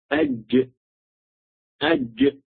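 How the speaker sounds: "أج" as a hamza leading into a closed jim, high-pitched; jim after hamza. Shown as a demonstration. Teacher shows students how to find the letter, jim after hamza. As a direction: sound out "أج" high, stopping on the consonant. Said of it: "ضع قبله همز".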